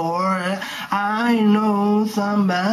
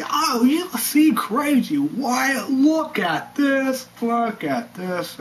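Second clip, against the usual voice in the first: high, slow mocking pundit voice; 7 semitones higher, slower than usual. Vlogger mocking a TV pundit's outrage, high and slow. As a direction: sneer it out like a TV pundit.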